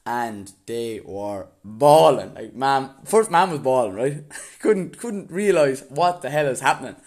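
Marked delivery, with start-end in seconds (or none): none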